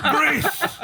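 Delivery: high, loud mock-angry shout; mad dad's yell. Son imitating mad dad holding up the tub, mock-angry yell. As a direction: yell it like an exasperated Filipino dad. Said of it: "he would get all mad".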